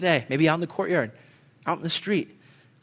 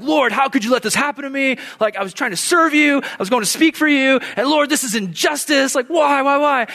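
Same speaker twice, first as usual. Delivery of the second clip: high-pitched, loud, aggrieved cry of injustice; angry protest; 11 semitones higher, 4 dB louder than usual. Retold or imitated. Late youth leader protesting a ticket to God, hammed-up anger. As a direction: wail it at heaven, indignant and over the top. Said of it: "I'm just angry".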